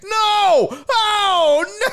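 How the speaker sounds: high, loud, laughing cry of no; mock dismay shout. Imitating the losing team's despair, laughing shout. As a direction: howl like the losers watching it slip away.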